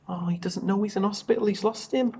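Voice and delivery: ordinary delivery, nothing marked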